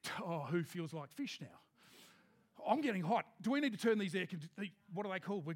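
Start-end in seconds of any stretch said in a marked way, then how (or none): 0.0-1.6 low, fast, chatty chef pitch; Jesus the showman cook. Retold or imitated. Jesus tempting friends with his barbecue, lower chef voice. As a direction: sell the meal like a TV cook. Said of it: "he says".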